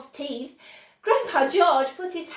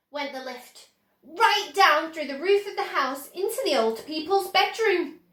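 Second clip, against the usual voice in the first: usual speaking voice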